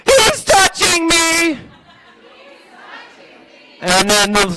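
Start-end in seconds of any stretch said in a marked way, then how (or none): 0.1-1.6 put-on high, slow whining child cry; whiny kid. Shown as a demonstration. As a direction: wail it like a tattling kid. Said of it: "and you're gonna say".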